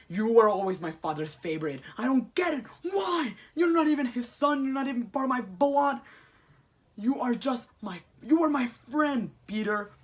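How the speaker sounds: high, loud, hurt outburst; hammed-up jealous tirade; anguished rant. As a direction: rail at a friend who betrayed you. Retold Goblin rants at Peter.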